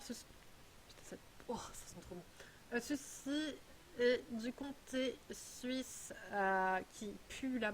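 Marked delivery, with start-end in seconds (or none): 0.0-2.2 hushed aside. Real, not acted. Sniffing the cheese, a whispered aside to herself. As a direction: breathe it out while sniffing the cheese. Smelling the strong Swiss cheese.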